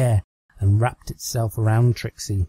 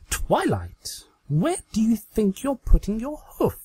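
higher, playful mock-scandalized scolding; mock indignant Trixie; 11 semitones higher than usual. Trixie scolding her Twilight doll in higher mock indignation. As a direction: pretend to be shocked at the doll.

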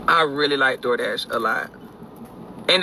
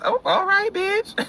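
much higher, sing-song all right; high mock reply; slower than usual. Answering the driver in a high, sing-song mock voice. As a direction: play it high and breezy. Her own reply, acted.

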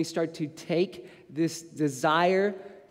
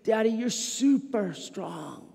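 put-on four-year-old son; 2 semitones higher, 3 dB quieter, slower than usual. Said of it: "he says".

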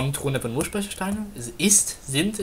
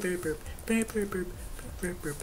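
ordinary talk

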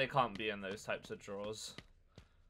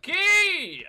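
excited shout; 13 dB louder than usual. Real, not acted. Found key, excited.

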